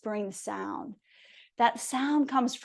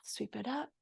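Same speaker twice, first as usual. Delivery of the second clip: soft breath cue; 13 dB quieter than usual. Shown as a demonstration.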